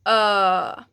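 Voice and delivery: loud "ö" from deep in the throat; deep vowel. Shown as a demonstration. Teacher showing ö sound, deep. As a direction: let "ö" vibrate low. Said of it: "feel it vibrating here in your throat".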